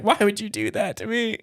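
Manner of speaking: high mock-upset whine; mom's wounded protest. Scared mom to her prankster kid, high wounded protest. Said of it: "she's like".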